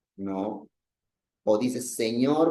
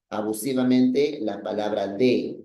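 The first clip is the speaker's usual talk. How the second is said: normal talking voice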